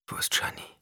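breathy hushed question; whisper. A narrator's reading. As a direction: whisper it, barely voiced. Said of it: "flüsterte Paul".

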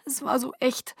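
high, soft tearful voice; small, choked sob of a voice; crying. A narrator's reading. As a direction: say it while weeping. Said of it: "sagte ich weinend".

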